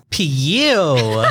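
long, swooping mock "pew"; exaggerated impersonation. Co-host mimicking partner's smell habit, exaggerated. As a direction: stretch the disgust out, laughing. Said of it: "I definitely don't say it like that".

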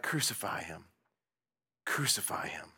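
low, soft, almost whispered; hushed crowd cry. Retold or imitated. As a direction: say it like a distant murmur. Crowd cries for crucifixion.

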